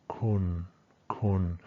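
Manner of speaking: "kun" held level and low; level flat tone. Shown as a demonstration. Teacher shows the final tone, "kun" level and flat. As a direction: keep "kun" on one even pitch. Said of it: "always a level flat tone".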